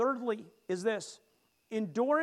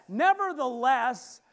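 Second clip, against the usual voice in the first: nevertheless slow and much louder; louder with conviction; 3 semitones higher, 7 dB louder, slower than usual. Shown as a demonstration. Preacher leading the congregation, slow loud nevertheless. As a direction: say nevertheless like you mean it. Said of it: "Say it louder with conviction".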